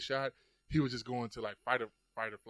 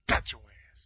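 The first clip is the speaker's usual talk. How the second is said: hushed triumphant whisper; whispered gloat; 7 dB louder than usual. Retold or imitated. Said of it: "I was like".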